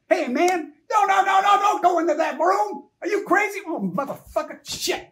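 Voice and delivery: very high, loud frantic warning; yelling at screen. Retold or imitated. Moviegoer yelling at horror film screen, loud and frantic. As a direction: scream at the character not to open the door. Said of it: "they would be yelling at the screen".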